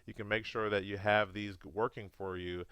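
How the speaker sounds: normal talking voice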